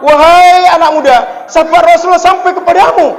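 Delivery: called out high and loud; joyful announcement. Acted. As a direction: announce the pardon across the room. Umar calls out good news. (Umar memanggil pemuda dengan suara lantang dan gembira)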